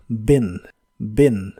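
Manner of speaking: "been" said short and punchy like bin; low, short "been". Shown as a demonstration. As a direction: clip "been" to a quick short i. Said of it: "pero corta y explosiva".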